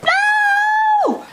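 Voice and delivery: high yelled no; mock no. Kid retelling brother grabbing his toy, high mock yell. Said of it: "I was like".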